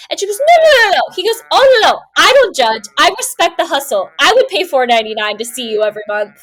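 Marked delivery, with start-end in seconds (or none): 0.4-1.1 loud, high, rapid no's; flustered denial. Retold or imitated. Friend denies doing OnlyFans to the drunk man, much higher and louder. 1.5-6.4 higher, louder, pushy reassurance; eager drunk man. Retold or imitated. Drunk stranger assures the friend he respects her hustle, loud and high.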